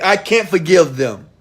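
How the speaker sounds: imitating a listener who refuses to forgive, loud and pained; mock anguished protest. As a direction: cry out the refusal like someone still hurt. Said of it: "Some of you gonna say".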